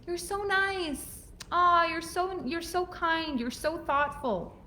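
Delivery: high, slow syrupy voice; sugary condescending praise. Shown as a demonstration. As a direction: gush sweetly like praising a child. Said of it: "Or you saying".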